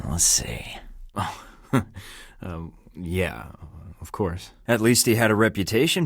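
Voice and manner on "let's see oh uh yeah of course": whisper. Acted. Sly teasing aside to Darcy.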